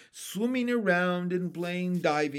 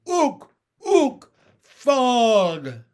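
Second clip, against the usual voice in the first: very high hoots, then a long loud cry; piercing, froggy holler; triumphant shout; 9 semitones higher, 12 dB louder, much slower than usual. A narrator's reading. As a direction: announce it like an overjoyed frog. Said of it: "Pin shouted".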